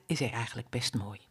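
whispered fondly, no voice; whispering mother duck; tender whisper. Acted. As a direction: hush it like a secret. Mother duck admiring her chick.

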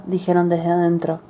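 ordinary delivery, nothing marked

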